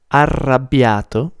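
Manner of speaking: arrabbiato with a strongly rolled double R. Shown as a demonstration. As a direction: roll the R in arrabbiato. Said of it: "it's a very good word to practice your R".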